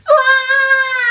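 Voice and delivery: very high, loud anguished cry; child wailing at his punishment. Acted. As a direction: wail in despair. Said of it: "wailed Peter".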